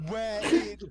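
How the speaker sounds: normal talking voice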